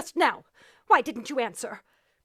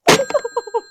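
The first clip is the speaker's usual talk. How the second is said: nervous laugh; 5 semitones higher, 5 dB louder than usual. Acted. Hysterical after phone silence.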